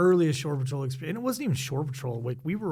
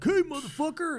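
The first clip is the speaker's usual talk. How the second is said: mock shore patrol challenge, pitched way up; retold call-out; 13 semitones higher than usual. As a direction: call out to catch someone in the act.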